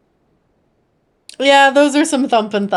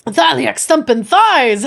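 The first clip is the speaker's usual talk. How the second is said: slow, hearty Scottish oath; a slow, hearty Scottish-style "Thaliak stumpin' thighs"; Scottish exclamation; 4 semitones higher, 6 dB louder, slower than usual. Acted. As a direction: belt it out slowly like a dwarf swearing. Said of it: "reading dwarf exclamation".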